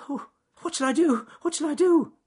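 high, loud frantic wail; panicky rabbit. Acted. Rabbit dragging the snake cries out in high panic.

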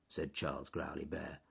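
plain reading voice

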